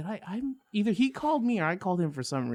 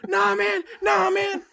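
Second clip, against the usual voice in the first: freaking out; 5 dB louder, slower than usual. Retold or imitated. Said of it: "Dude, he lost his shit".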